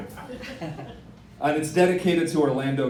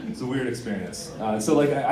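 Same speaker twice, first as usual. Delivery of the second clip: ordinary delivery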